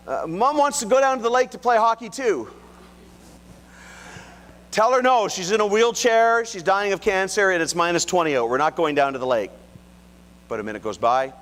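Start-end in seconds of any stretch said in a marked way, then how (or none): 0.1-2.5 calling up. Retold or imitated. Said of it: "he calls back up". 4.7-9.5 high, loud firm refusal; calling down. Retold or imitated. Dad shouting down the stairs to his son, loud firm no.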